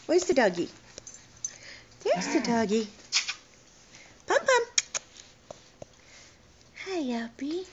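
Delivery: put-on high, sing-song voice for a toddler; reading to a toddler. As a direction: coax a little one, bright and lilting. Mother coaxing toddler to dog.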